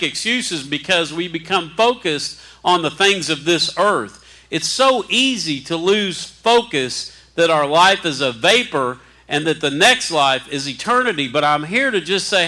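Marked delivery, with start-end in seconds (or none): none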